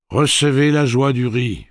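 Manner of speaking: high, loud, stately gunslinger speech; Roland's formal address. Acted. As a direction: announce it grandly to the whole crowd. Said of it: "dit Roland".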